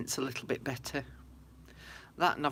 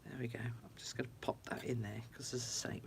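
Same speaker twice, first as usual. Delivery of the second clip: low softer whisper while working; whispered aside; 5 semitones lower, 9 dB quieter, faster than usual. Real, not acted. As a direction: murmur it to yourself.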